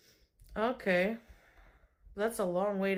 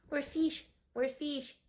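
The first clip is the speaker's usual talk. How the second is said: put-on high sing-song baby talk; cat talk; 7 semitones higher, slower than usual. Owner to her cat, high baby talk.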